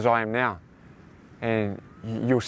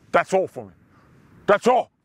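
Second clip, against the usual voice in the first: mock resignation; 6 semitones higher, 4 dB louder than usual. Mocking limited thinking.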